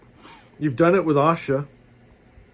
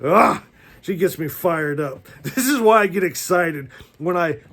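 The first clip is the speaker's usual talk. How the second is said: normal talking voice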